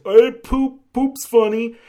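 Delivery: high, loud, hammed-up dimwit; dumb mock voice. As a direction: play a simpleton delighted by poop jokes. Mocking the film's humour.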